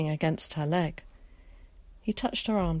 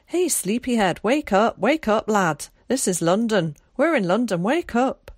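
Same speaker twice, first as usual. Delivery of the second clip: louder, higher, rousing a sleeper; urgent wake-up call; 4 semitones higher, 5 dB louder than usual. Acted. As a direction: call out to shake him awake. Scots boy shaking him awake.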